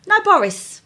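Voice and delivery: high, alarmed call for the lost dog; calling out in sudden panic; panicked cry. Retold or imitated. Panicked search for missing dog.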